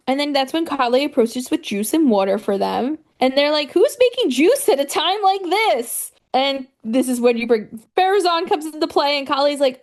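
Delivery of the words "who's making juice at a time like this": high, swooping disbelief; mock incredulity. Friends reacting to juice at a bad time, incredulous. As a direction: question it high, as if it's absurd.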